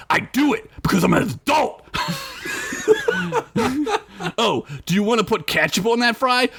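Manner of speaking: ranting orc. Acted. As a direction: grumble on like a disgusted orc. Big orc rants about fries.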